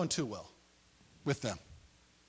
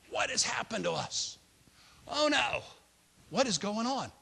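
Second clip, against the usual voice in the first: panicked; 6 semitones higher than usual. Retold or imitated. Brothers panicking on way home.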